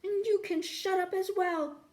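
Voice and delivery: high, loud exasperated snap; irritated snap. Acted. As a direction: snap back at the guard, fed up. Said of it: "snapped Ford".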